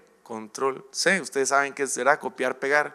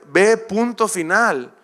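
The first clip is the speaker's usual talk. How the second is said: louder, slow, stern order; firm command from God; 5 semitones higher, 4 dB louder, slower than usual. Acted. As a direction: lay down the order with no room to argue. God answers excuses firmly.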